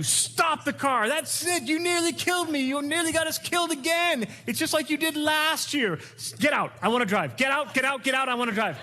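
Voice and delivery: high, panicked mock wife yelling to stop the car; high-pitched mock panic from the passenger seat. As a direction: play the frightened wife demanding the wheel. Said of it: "begins to just yell at you".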